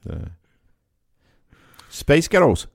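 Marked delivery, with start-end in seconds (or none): 1.9-2.7 higher, louder attempt at an American accent; punched-out mock American accent. Shown as a demonstration. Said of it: "in an American accent".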